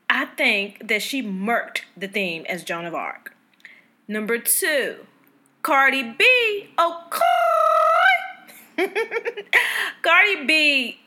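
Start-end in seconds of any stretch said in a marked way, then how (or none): 5.6-11.0 high, loud, drawn-out gushing; excited hype. Real, not acted. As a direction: hype her up, big and slow on the name. Gushing over Met Gala favourite.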